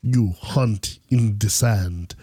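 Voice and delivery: low, very slow speech with long gaps; Boba, sparse talker. Retold or imitated. Mimicking a hero who doesn't talk much, terse and slow. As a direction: dole out the words slowly and low, pausing between. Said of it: "He's like".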